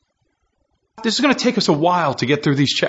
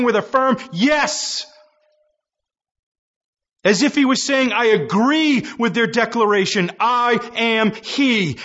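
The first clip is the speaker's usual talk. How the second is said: high, impassioned pulpit voice; fervent high sermon delivery; preaching at full force; 9 semitones higher than usual. Acted. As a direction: drive the point home to the congregation.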